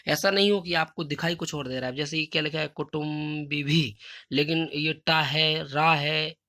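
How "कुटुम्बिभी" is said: कुटुम्बिभी spelled out slowly, syllable by syllable; slow careful pronunciation. Shown as a demonstration. Teacher shows pupils how to say कुटुम्बिभी, slow and careful. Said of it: "देख लें कि इसका उच्चारण कैसे होता है".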